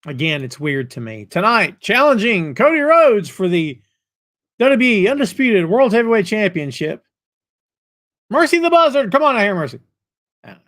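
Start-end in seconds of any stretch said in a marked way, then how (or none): 1.2-9.8 mock ring announcer. Host mockingly introduces a wrestler for a title match, ring announcer. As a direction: announce the challenger, rising high on the name.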